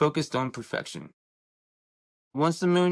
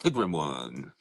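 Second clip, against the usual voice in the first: deep pitched-down voice changer effect; 10 semitones lower, 3 dB quieter than usual. Real, not acted. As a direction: voice changer dropping the voice low. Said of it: "I like the voice changer thing".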